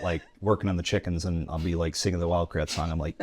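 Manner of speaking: normal talking voice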